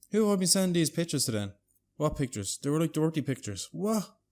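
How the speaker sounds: high-pitched, loud dismay; mock panic, pitched well up; acted alarm. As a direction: imitate someone realising a terrible mistake. Acted panic over pictures sent wrongly.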